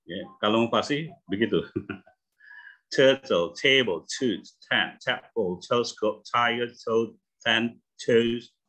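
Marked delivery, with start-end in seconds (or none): 2.9-3.5 "Turtle" with a strong breathy puff on the T; Turtle, with the T aspirated like an H. Shown as a demonstration. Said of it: "aspirasi udah kayak H-nya". (T "Turtle" diucapkan beraspirasi, seperti ada bunyi H)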